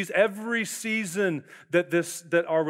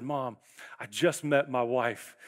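hushed, awed confession; 6 semitones lower, 5 dB quieter, slower than usual. Retold or imitated. Calling mom after first date.

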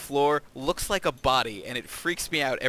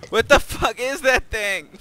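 freaked out; 9 semitones higher, 9 dB louder, slower than usual. Real, not acted. Sees a creepy monster.

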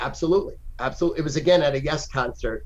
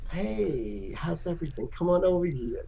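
soft, sweet switch to welcome; sudden friendliness; 3 semitones higher, 6 dB quieter than usual. Retold or imitated. Stoner flipping from rage to soft sweet welcome. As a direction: coo it like a mellow friend. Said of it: "how insane it is".

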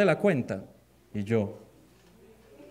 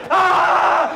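high drawn-out comic shriek; hammed-up panicked scream; mock scream of fright; 9 semitones higher, 5 dB louder than usual. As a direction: shriek as if a rat just looked at you. Sees a rat at night.

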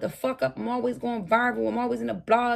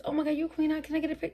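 higher, gushing fan voice; mock fan; 6 semitones higher, much faster than usual. Streamer mocking fans who ask her for a pic, higher gushing voice. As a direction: play the starstruck fan asking for a photo.

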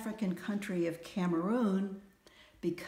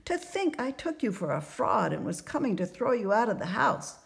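raised, emphatic voice of an impressed landlady; gushing merchant's wife, awed; 5 semitones higher, 6 dB louder than usual. A narrator's reading. Merchant's wife offers free lodging for fortunes, awed gushing. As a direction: gush eagerly to win over an honored guest.

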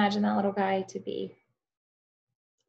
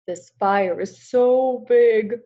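slow, loud, drawn-out howl; howling wolf; 3 semitones higher, 9 dB louder, much slower than usual. Acted. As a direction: stretch big like a wolf's howl. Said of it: "howled Wolf".

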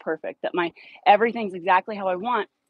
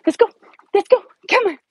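much higher, louder calling; excited calling; 13 semitones higher, 10 dB louder, faster than usual. Real, not acted. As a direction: call the dog on eagerly.

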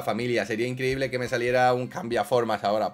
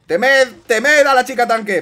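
mock battle cry; 12 semitones higher, 11 dB louder than usual. Proclaims tank girl power.

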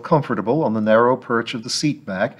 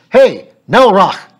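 much higher, louder shout across traffic; yelled hail; 11 semitones higher, 12 dB louder, slower than usual. Acted. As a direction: yell to stop the cab. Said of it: "and yelled".

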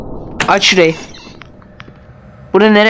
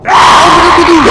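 high, drawn-out panicked scream; terrified scream; 12 semitones higher, 6 dB louder, slower than usual. Real, not acted. Caught by granny, screams. (korkuyla çığlık)

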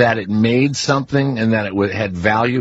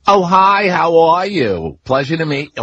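mock Louisiana accent; 6 semitones higher, 4 dB louder than usual. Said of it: "The, the accent was so awful".

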